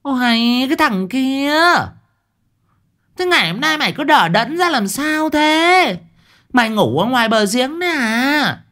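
high-pitched nagging woman's shout; scolding yell. A narrator's reading. Mother yelling at the dawdling boy at the well, scolding. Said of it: "Bà Lụa đứng cho chỏa quát".

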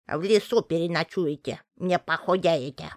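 put-on snappish old witch voice; witch snapping back. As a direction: snap back at the guests. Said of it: "огрызнулась старуха".

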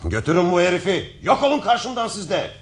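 furious royal command, high and sharp; angry shout. Acted. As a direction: bark the order in a rage. King orders guards away.

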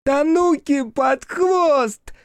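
high, slow, piercing scream. Acted. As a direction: shriek it so ears ring. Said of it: "заорал Кикирики так, что зазвенело". (пронзительный крик)

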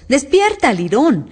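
high, loud call; shouted storybook command; wake-up shout. A narrator's reading. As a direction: rouse a sleeper with a sharp cry. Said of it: "chillaron los otros dos".

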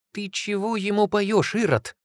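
high, slow, outraged shout; indignant woman's exclamation. A narrator's reading. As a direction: scold him in a high female voice. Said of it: "воскликнула".

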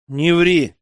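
short, firm cut-off; curt rebuke. A narrator's reading. Elder confronts the merchant's daughter over her lie, curt. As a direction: cut her excuse short, a touch forceful. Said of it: "отрезал я".

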